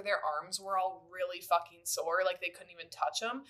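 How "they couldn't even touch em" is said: ordinary talk, nothing marked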